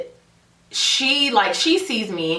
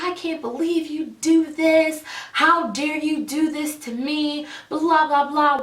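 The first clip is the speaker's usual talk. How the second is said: mock yelling; 8 semitones higher than usual. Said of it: "yelling, like".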